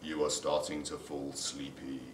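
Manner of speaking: low, slow, soft hypnotist drone. Acted. Prankster hypnotising a phone salesman, slow and low. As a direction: lull them under, low and slow.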